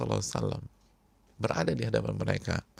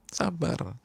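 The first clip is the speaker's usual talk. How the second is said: ordinary talk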